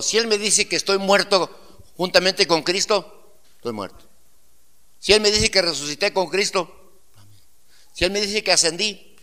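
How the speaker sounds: impassioned sermon voice; fervent preaching. Acted. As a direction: preach it with rising conviction. Preaching to congregation.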